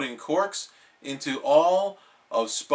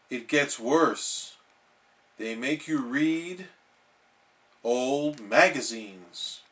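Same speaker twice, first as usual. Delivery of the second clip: slow, dramatic warning; ominous slow telling; slower than usual. Acted. Patrick scaring SpongeBob about the doctor. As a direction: draw it out like a horror tale.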